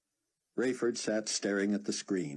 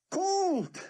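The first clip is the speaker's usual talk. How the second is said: high, loud joyful cry; exulting; 5 dB louder than usual. A narrator's reading. Yaakov exulting over the cold water, high and loud. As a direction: burst out with joy. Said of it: "Yaakov exulted".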